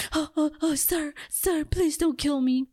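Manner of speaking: flat, unconvincing fake pleading; bad acting. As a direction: plead with no real fear. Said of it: "she didn't seem scared at all".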